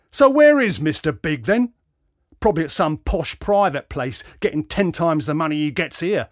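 loud, sneering outburst; snapping. A narrator's reading. Patient resenting the absent surgeon, snapping. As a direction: snap it with resentment. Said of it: "the man snapped".

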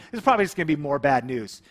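ordinary talk, nothing marked

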